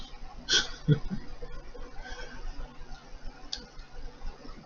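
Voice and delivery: a long real laugh, rising; amused laugh. Real, not acted. Laughing at the racing team's ridiculous colors, amused laugh.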